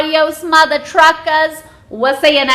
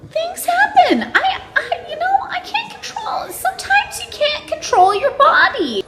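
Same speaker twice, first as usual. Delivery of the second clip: giggling. Real, not acted. Giggling through the closing line.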